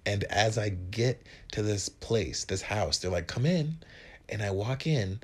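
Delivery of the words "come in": high welcome. Retold or imitated. Roommate greeting.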